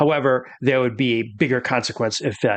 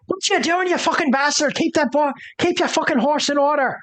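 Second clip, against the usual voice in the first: higher-pitched angry woman yelling; 12 semitones higher than usual. Acted. Game master voicing a furious woman at the rider. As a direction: snap at the rider in a high put-on voice. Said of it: "she's like".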